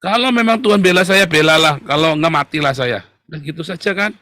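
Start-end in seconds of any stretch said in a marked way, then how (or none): 0.1-3.0 loud, high burst of preaching; defiant declaration. Real, not acted. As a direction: throw it out as a bold challenge. Defiant declaration of trust in God. (seruan lantang penuh tantangan)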